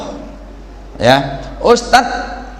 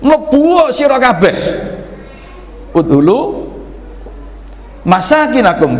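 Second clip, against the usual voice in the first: commanding call; 8 semitones higher than usual. Shown as a demonstration. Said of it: "fi'il amr, kata kerja perintah".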